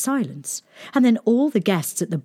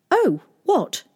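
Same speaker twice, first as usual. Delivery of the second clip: princess voice; 11 semitones higher than usual. A narrator's reading. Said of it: "she said".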